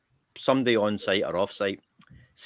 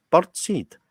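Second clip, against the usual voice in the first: repeating to be understood; 8 semitones higher, 6 dB louder than usual. Retold or imitated. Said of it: "I said".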